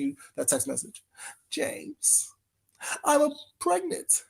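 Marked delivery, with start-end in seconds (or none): 1.5-4.2 high mock-melodrama, drawn-out ending; movie-scene impression. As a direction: start hushed, swell into the big confession. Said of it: "It's from a movie".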